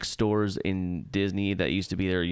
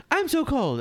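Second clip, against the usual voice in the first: much higher, hammed-up cold; high shivering voice. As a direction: squeak it like freezing. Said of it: "she goes".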